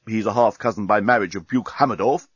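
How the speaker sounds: ordinary delivery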